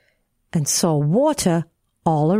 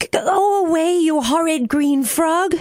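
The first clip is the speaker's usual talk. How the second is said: mildly tearful; 9 semitones higher than usual. A narrator's reading. Crying girl to the horrid green frog, high tearful protest. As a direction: send the creature away in a lightly acted wail. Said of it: "to cry again".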